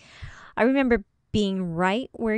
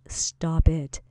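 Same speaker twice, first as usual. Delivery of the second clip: whispered stop it, no voice; 2 semitones lower than usual. Acted. Coach whispering the reminder to a listener in a funk. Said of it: "remember those two words".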